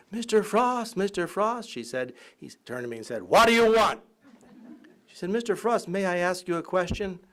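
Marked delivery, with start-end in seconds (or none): 0.1-1.7 put-on higher, bright girlish call; voicing a young admirer, higher; eager girl calling. Girl voiced calling to Frost. 3.2-4.0 louder, higher gruff demand. Retold or imitated. Gruff Frost voiced in anecdote. 5.3-7.2 put-on higher, polite girlish voice; timid girl asking.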